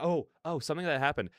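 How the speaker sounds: ordinary talk, nothing marked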